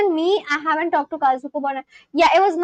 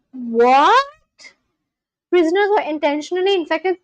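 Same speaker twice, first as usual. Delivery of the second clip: shocked; 3 semitones higher, 4 dB louder than usual. Real, not acted. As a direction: gasp it out in rising disbelief. Shocked reaction to what she reads.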